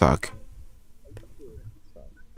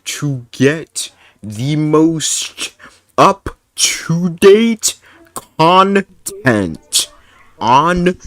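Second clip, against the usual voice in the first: very slow, loud, high syllable-by-syllable plug; grandiose sign-off; 7 semitones higher, 7 dB louder, much slower than usual. Pretend. Streamer plugging the channel to viewers, hammed-up grand voice. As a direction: stretch it out with mock pomp.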